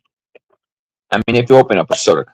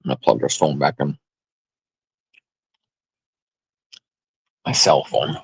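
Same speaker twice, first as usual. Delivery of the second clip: chewing while talking; 5 semitones lower, 5 dB quieter than usual. Real, not acted. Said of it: "I'm sorry I'm chewing in your guys' ear".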